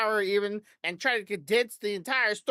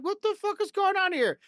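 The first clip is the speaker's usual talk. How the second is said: exasperated outburst; 10 semitones higher than usual. Retold or imitated. Frustrated at broken audio.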